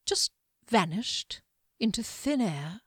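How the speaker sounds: ordinary delivery, nothing marked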